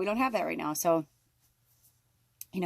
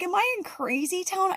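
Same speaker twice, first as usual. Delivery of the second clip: high-pitched exasperated disbelief; incredulous question; 11 semitones higher than usual. Retold or imitated. Questioning partner's sense mid-argument, incredulous. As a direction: act out being utterly baffled.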